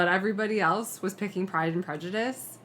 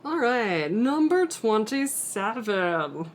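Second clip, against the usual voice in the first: slow, booming Muppet announcer; fighting game announcer; 4 semitones higher, much slower than usual. Acted. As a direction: drag it out like a Muppet host. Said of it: "my announcer voice is getting super Muppety".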